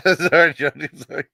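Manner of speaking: giggling through a sorry; sorry, Johnny, half laughing; laughing apology. Real, not acted. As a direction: apologize while cracking up.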